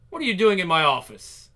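father demanding answers from his daughter; stern demand. Acted. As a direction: confront her in your office.

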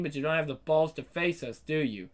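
usual speaking voice

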